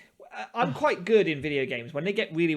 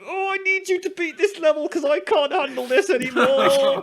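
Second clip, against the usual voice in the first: high, louder, laughing pleading whine; mock desperate plea; 10 semitones higher, 5 dB louder than usual. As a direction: beg like you can't take it anymore. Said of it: "and I'm like".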